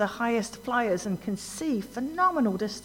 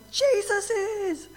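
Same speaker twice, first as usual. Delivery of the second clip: much higher, very slow, drawn-out announcement; awed revelation; 13 semitones higher, much slower than usual. Acted. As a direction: reveal it slowly in awe. Sudden triumphant answer in the vision.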